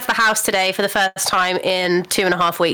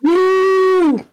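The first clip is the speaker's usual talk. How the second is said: high, loud whoop; loud, high birthday woo; excited cheer; 11 semitones higher, 4 dB louder than usual. Real, not acted.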